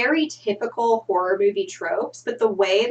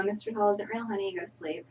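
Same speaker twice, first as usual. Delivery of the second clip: soft soothing mother; 10 dB quieter than usual. Acted. Mother soothing child again.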